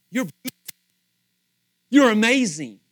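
usual speaking voice

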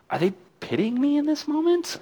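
very high, squeaky-voiced suspicion; mock doubt. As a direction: wonder it aloud in a comically high voice. Acts out doubting the blessing.

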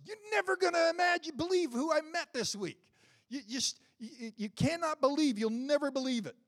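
high, loud excited shout; bursting excitement. Retold or imitated. Woman tells her friends who she met, in a high loud excited shout. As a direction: blurt it out, can't contain it.

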